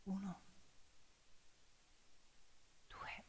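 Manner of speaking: slow, near-silent countdown with long pauses; barely audible count, breath held; hushed counting. Acted. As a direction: count each number under your breath to steady the fear. Counting fingers before the start.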